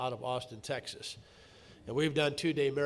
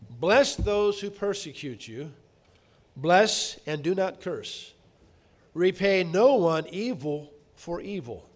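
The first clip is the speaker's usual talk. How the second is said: slow, louder solemn reading; scripture reading; 2 semitones higher, 6 dB louder, slower than usual. Acted. Preacher reading Romans twelve aloud to the congregation, solemnly. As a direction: proclaim each verse deliberately.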